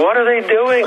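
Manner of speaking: high-pitched, incredulous retold question; re-enacted amazement; stunned question. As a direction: ask it in baffled disbelief, pitched well up. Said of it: "that really stunned me".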